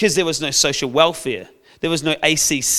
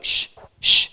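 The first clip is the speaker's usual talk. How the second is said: whispered shush. Retold or imitated. Disciples hushing the beggar, whispered shush. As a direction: hush him quickly. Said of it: "Even the disciples are like".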